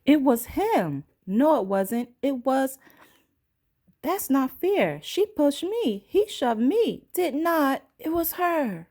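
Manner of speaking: put-on high, childish bickering voices; squabbling blame. Shoppers blaming each other like squabbling kids. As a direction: whine and point the finger.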